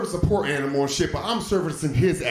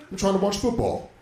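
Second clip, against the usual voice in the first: usual speaking voice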